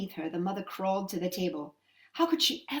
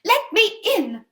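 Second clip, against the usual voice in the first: calling; 11 semitones higher, 10 dB louder than usual. Acted. Mother at the witch's door, calling out loud. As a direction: pound on the door with your voice. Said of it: "the mother called".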